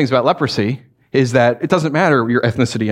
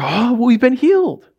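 amazed realization; 9 semitones higher, slower than usual. Retold or imitated. Lepers realize healing.

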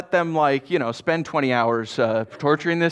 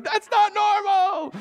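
very high, drawn-out comic panic; freaked-out kids; 3 dB louder, slower than usual. Retold or imitated. Kids answering dad about the tongue, slow high mock freak-out. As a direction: wail it slowly like an alarmed kid. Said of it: "my kids are freaking out".

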